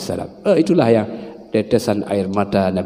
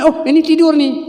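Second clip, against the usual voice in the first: much higher voice of a mother; lightly voiced mother; mother reading baby's cry; 4 dB louder than usual. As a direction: play a mother who knows the hungry cry. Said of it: "nadanya lain".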